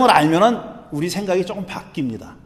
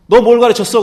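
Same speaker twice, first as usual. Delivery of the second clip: higher, louder mock interrogation; raised-voice questioning, acted; acted interrogator; 4 semitones higher, 8 dB louder than usual. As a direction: demand an answer like an interrogator. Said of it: "신문하는 사람한테".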